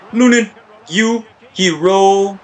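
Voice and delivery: stretched-out, theatrical hero call; slow, hammed-up tribute; dramatic praise. Real, not acted. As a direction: hail the keeper with mock grandeur. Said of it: "I don't know why I said it like that".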